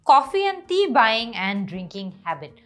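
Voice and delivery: slow topic read. Shown as a demonstration. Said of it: "আমাদের আজকে writing task ওয়ানের টপিকটা কি".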